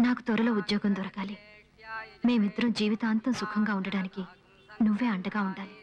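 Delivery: a woman praying before the deity. Acted. Prays before the god.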